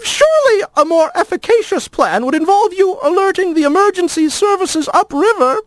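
very high, formal and prim; a nervous, wordy lizard; fussy lizard voice. A narrator's reading. Lizard character lines.

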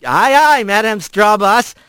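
high, hammed-up sailor's reply; mock salute. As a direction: answer the boss with playful swagger. Rat salutes Kylie's command.

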